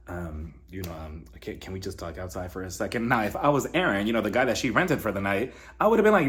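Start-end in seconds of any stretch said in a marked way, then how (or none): 0.1-3.1 low, soft, hesitant imitation; hushed request. Ex asking her to talk outside, low and hushed. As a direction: murmur it low with halting ums.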